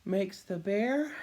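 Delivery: slow suspense build. A narrator's reading. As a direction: build the tension to the sneeze. Suspense before the sneeze.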